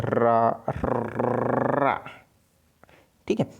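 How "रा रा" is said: रा with the tongue trilling on the r; trilled ra demo. Shown as a demonstration. Teacher repeats the trilled consonant, rolled ra. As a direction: roll the र in रा again and again. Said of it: "इसके उच्चारण में कंपन होता है".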